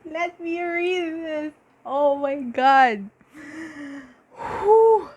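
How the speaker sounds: high and slow, catching her breath with excitement; steady yourself through giddy excitement; high, slow, breathless giddiness. Real, not acted. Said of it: "I'm, I'm really sorry. I just feel so giddy".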